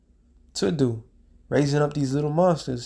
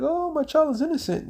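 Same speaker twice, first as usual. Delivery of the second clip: mock crying parent; 13 semitones higher than usual. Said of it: "parents be crying like".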